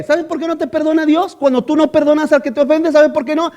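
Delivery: ordinary talk, nothing marked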